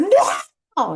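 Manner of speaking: mock toddler yell, high and loud; upset toddler. Upset toddler refusing his mother, imitated high yell. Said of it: "he was still upset".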